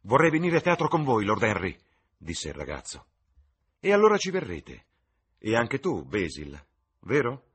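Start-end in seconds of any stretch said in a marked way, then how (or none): 0.1-1.8 put-on high, loud, boyish eagerness; excited youth asking to come along; eager. Eager youth asks to join. 3.8-7.5 high, loud, delighted youth; excited, boyish insistence; eager urging. Acted. Delighted youth urges both friends.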